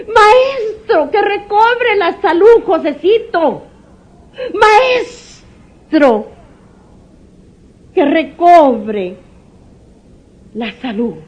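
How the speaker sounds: put-on high, crying plea for a sick child; weeping prayer for Josecito; tearful plea. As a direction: beg the Master through tears. Parent begging for sick child.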